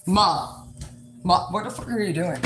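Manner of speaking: mock exasperated son yelling at mom; imitating her son. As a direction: play the appalled teenage son. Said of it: "This is exactly what he said".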